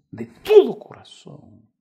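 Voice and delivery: loud, high, slow cry of de todo corazón; preacher's emotional peak; impassioned cry. Acted. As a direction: plead with full force.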